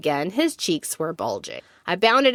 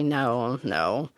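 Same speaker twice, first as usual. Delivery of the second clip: low, softer reluctant grumble; grumbling; 6 semitones lower, 6 dB quieter than usual. A narrator's reading. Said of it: "They all grumble and say".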